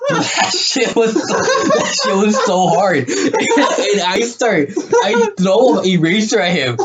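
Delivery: high, slow, laughing storytelling; cracking up. Real, not acted. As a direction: struggle to tell it through real laughter. Cracking up while telling boner story.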